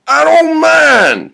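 slow, high holler; long drawn-out yell; shouting. Acted. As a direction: bellow it back, stretching the words. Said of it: "shouts Bill".

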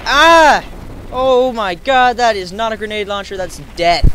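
loud shocked yell, drawn out; startled shout. Real, not acted. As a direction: react loud to a sudden blast. Startled by explosion.

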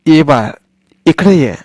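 a warm summons; calling grandmother. Acted. Grandmother calling Sonya back to her, affectionate.

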